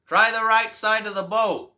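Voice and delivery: loud, high call across the water; man on shore calls to the fishermen; crying out. Acted. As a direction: shout the tip out to the boat. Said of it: "cries out".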